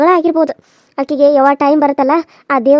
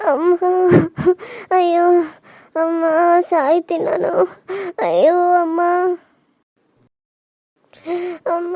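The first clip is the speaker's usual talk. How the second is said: groaning in pain, begging between moans; labour pain moans. Acted. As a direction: moan through stomach cramps. (ಹೆರಿಗೆ ನೋವಿನ ನರಳಾಟ)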